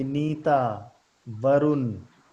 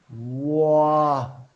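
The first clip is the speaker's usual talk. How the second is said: "वाह" drawn out very slowly; slow motion; 5 dB louder, much slower than usual. Shown as a demonstration. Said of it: "बहुत slow motion में बोलो".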